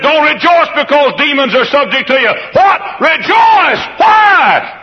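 high, loud proclamation; preacher's shout. Acted. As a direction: thunder the command at them. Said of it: "and Jesus said".

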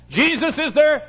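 high, loud, drawn-out proclamation; full preaching shout. Real, not acted. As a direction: cry out the refrain slowly. Refrain builds to a shout.

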